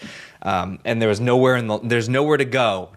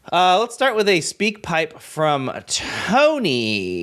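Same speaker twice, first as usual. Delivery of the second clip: slow, higher host announcement; announcer voice; 8 semitones higher, 3 dB louder, much slower than usual. Acted. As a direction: present the segment like a show host. Introduces the listener segment.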